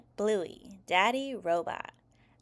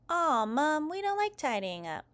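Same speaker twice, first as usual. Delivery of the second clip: put-on higher-pitched kid objecting to chores; child's whiny protest; 7 semitones higher than usual. A narrator's reading. Kids told by Mom to tidy up, whiny protest.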